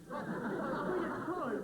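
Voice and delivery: high, loud and slowly drawn out; hammed-up panic that the baby is coming; mock labour alarm. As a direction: cry it out like labour has begun. Mock labour pains announced.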